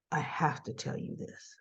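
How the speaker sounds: very soft, low and serious; hushed insistence. Retold or imitated. Man pressing to tell her hard news, hushed insistence. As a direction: imitate a confession, almost whispered. Retells man's reluctant insistence.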